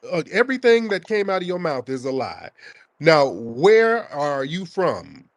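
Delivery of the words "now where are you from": slow demand. Real, not acted. Host presses caller on origin.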